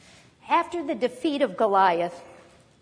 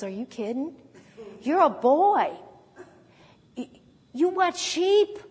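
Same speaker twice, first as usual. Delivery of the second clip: acted disbelief, rising higher and slowing; incredulous King Saul; 4 semitones higher than usual. King Saul sizing up young David, incredulous. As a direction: draw out the put-down in mock disbelief. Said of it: "Saul takes one look at him and says".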